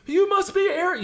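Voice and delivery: high, loud mock captain; captain's voice. Captain calling the recruit arrogant. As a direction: sneer it like the captain. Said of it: "He goes".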